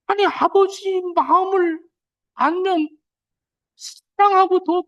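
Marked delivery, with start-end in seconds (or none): none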